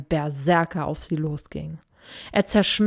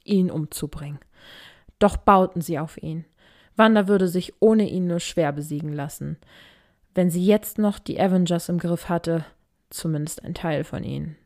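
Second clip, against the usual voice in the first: ordinary delivery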